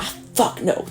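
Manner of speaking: breathy, near-whispered recoil; a soft, horrified no; disgusted refusal. Real, not acted. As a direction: shudder away from the idea. Recoiling from a consciousness upload.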